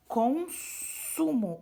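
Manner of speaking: consumo spoken very slowly, the s hissed plainly as s; slow consumo, show the s; clear S sound. Shown as a demonstration. Teacher pointing out the purple S, slow consumo with clear s. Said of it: "olha o Szinho roxo aqui".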